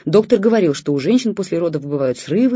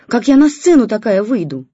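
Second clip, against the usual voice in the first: loud despairing yell; shouting; 6 semitones higher, 10 dB louder than usual. A narrator's reading. Wife shouts about going on stage. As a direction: cry out in despair. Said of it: "продолжала кричать жена".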